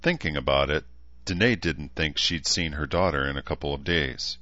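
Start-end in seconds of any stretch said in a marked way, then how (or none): none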